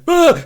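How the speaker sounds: short high, loud imitated yelp; mock jump-scare scream. Player rounding a corner into a jump scare, mock scream. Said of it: "with a scream".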